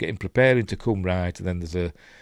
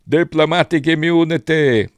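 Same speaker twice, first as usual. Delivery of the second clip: loud, higher mock South African accent; hammed-up guttural South African accent; shitty South African accent; 7 semitones higher, 7 dB louder than usual. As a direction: belt out a bad guttural South African villain. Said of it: "got a kind of shitty accent, kind of guttural accent".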